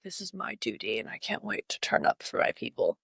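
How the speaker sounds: a bird vowing loyalty to its people, low and hushed; proud blue jay narration. Pretend. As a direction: murmur it low like a noble bird sworn to duty. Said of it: "He'd be like".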